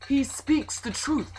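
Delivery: high, loud cry of approval; cheering. A narrator's reading. Friends back the first man's claim, cheering. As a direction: call it out loudly, cheering your friend on. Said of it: "his friends cheered".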